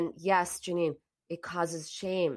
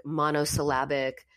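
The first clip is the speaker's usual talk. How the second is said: ordinary delivery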